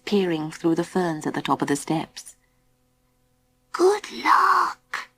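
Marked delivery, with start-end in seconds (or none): 3.7-5.1 high little beaver voice; tiny, very high voice; small creature voice. A narrator's reading. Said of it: "whispered Baby Bee".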